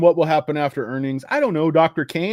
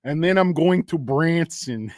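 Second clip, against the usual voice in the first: put-on slow, louder Yakov Smirnoff-style Russian accent; 3 semitones higher, 4 dB louder, slower than usual. Streamer impersonating the actor in a Yakov Smirnoff Russian accent. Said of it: "sounds like fucking Yakov Smirnoff when I do it".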